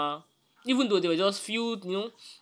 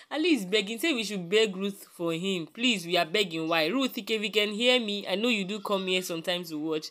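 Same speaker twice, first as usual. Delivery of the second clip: normal talking voice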